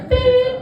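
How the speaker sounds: high squeaky mock beep; answering machine beep.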